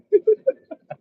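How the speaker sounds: high burst of real laughter; short high laugh; amused laugh. Real, not acted. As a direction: crack up at your own picture. Laughs at own story.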